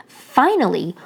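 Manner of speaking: plain reading voice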